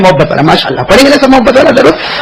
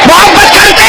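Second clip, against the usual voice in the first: preaching at full force; 11 semitones higher, slower than usual. Acted. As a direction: lead the crowd's vow at full voice. Leads crowd in pledge.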